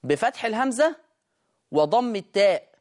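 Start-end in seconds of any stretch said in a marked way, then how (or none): none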